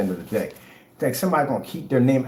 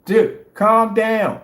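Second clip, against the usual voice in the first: loud calm down; 8 semitones higher, 7 dB louder, much slower than usual. Real, not acted. Telling the ranter to calm.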